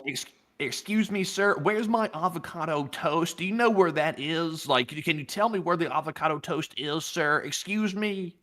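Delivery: high, slow, hammed-up Californian city-dweller accent; mock a Californian urbanite, drawing it out high; exaggerated urban Californian accent. Shown as a demonstration. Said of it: "put on the most urban accent ever".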